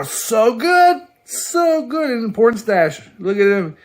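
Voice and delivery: high, loud and drawn out; gushing. Real, not acted. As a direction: swoon over the art. Gushing over a panel.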